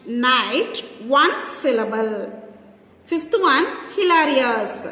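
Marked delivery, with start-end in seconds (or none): none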